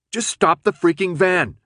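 high, loud exasperated order; exasperated yell. A narrator's reading. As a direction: shout it, out of patience. Desperate repeat of yelled order.

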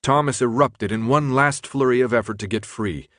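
ordinary delivery, nothing marked

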